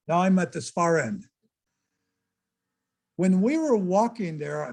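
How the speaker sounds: ordinary delivery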